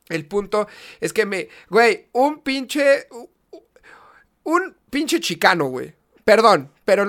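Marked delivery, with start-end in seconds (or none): 3.1-3.6 breathy stammered whisper. Real, not acted. Searching for the word in a whisper. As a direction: trail off into a whispered stutter. Stammering before "pinche chicano.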